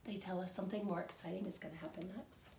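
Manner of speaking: usual speaking voice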